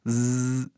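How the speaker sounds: "Z" as a long bare zzz; buzzing Z sound. Shown as a demonstration. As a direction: hold "Z" as a buzz without a vowel. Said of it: "é som de". ("Z" como zzz prolongado, sem vogal)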